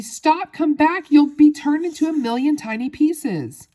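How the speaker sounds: high, loud frantic warning; panicked scream. A narrator's reading. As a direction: screech at your child to stop. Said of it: "screamed Missus Teavee".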